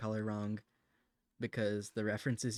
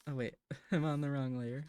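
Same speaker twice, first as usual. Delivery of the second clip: ordinary talk, nothing marked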